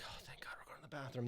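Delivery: fast, hushed retold aside; rapid whispered inner thought; relieved whisper. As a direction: whisper it quickly to yourself in relief.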